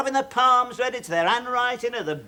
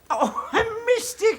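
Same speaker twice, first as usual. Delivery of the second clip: mocking disbelief; 11 semitones higher, slower than usual. Landlord mocks the lodger's claim.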